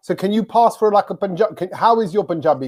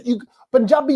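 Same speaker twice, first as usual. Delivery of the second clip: ordinary talk, nothing marked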